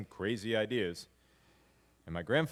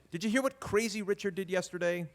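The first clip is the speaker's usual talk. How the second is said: gossiping relatives; 7 semitones higher than usual. Retold or imitated. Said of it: "as in".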